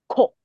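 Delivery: 考 with extra puff of air. Shown as a demonstration. Teacher correcting a student, 考 with stronger aspiration. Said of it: "气体再多一点点".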